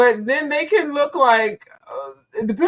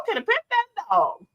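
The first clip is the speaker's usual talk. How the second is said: put-on a higher, whinier child plea; the kid asks again in a higher voice, begging; 10 semitones higher than usual. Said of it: "The mom is like".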